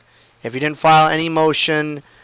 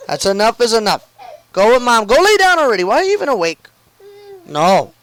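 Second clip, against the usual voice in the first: high, raised, fed-up parent voice; exasperated scolding; 6 semitones higher than usual. Real, not acted. Exasperated parent scolding awake child.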